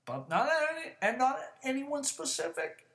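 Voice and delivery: high-pitched, agitated protest; yelling. Real, not acted. Insisting he targets nobody in his rant, yelling. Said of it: "I'm just fucking yelling about it".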